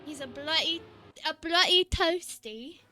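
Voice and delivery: a hint of a British accent on bloody toasty; lightly put-on British accent, bloody lifted; mock British accent. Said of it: "I gotta show my British".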